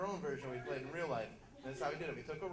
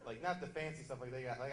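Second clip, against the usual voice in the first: usual speaking voice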